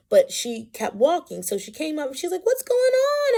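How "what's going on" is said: high-pitched, raised-voice query; imitated concerned question. Great-grandmother walking up to the bawling child, imitated concerned question. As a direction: call it out high, mimicking a passerby. Retold passerby arriving at crying child.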